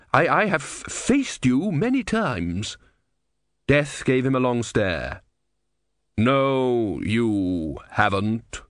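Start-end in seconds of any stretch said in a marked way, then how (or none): 0.1-2.8 high, halting nervous voice; nervous stammer. A narrator's reading. Stammering before Death. 6.2-8.6 grave and measured, like Death. A narrator's reading. Death speaks.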